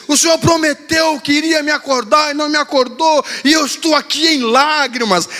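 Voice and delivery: lightly hammed daughter's complaint; mock-tearful daughter. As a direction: read her message like a wounded little girl. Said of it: "chorando assim".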